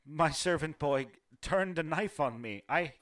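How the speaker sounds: a widow holding herself together, barely; grieving half-elf noblewoman. Acted. Half-elf widow telling the visitor of the night's horrors, bitter grief.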